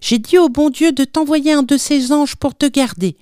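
put-on high little-boy voice; excited child. A narrator's reading. Little Odet questioning his father about the angel, excited. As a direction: pitch it up, eager like a child. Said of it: "s'écria Odet".

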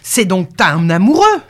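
loud cry right in someone's face; shouted accusation. A narrator's reading. Farmer accusing the girl of having a lover, shouting. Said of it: "lui cria dans la face".

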